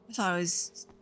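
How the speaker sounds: ordinary talk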